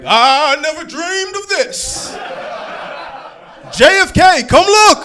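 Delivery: high, loud incredulous cry; shouted mock astonishment; Martin Luther King. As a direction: call out in amazement to someone nearby. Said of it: "Martin Luther King was looking up".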